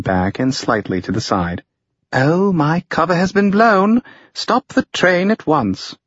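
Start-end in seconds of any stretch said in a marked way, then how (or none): 2.1-6.0 mock dramatic. A narrator's reading. Felice mock-dramatic, caught out.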